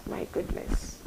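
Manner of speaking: breathless whisper. Real, not acted. Grieving her uncle's death.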